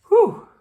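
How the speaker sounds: high, loud gasp of relief; loud relieved whew. A narrator's reading. Squirrel nearly drops the acorn, loud high whew of relief. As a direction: blow out the fear. Relief after near drop.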